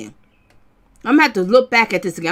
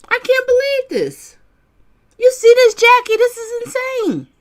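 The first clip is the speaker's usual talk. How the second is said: much higher, louder on calling Jackie; astonished, calling a viewer to look; shocked outburst; 10 semitones higher, 4 dB louder than usual. Real, not acted. Shocked at trial behaviour.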